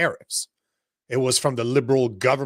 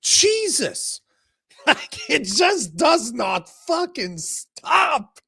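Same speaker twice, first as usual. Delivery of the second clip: exasperated outburst; 10 semitones higher, much slower than usual. Real, not acted. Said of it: "it never fucking ends".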